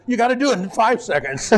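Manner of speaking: mock-frantic boss pressing for speed; urgent command. Director rushing the announcer, frantic mock urgency.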